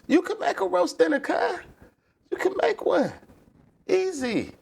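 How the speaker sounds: squeaky high put-on voice, egging on; high mocking voice. To chat, mocking a roast-dinner complaint in a squeaky high voice. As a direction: pitch way up and tease like a cheeky mate.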